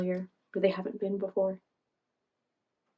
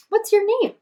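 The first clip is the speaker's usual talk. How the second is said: high Jumpet voice; 12 semitones higher, 9 dB louder than usual. Acted. Jumpet voiced in read-aloud story.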